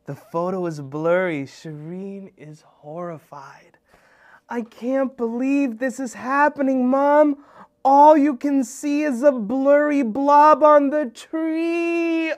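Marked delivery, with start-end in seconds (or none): 1.4-3.8 slow hushed whisper. A narrator's reading. Hushed narration. 4.5-12.3 higher, louder dismayed plea; distraught. Acted. Devastated girl.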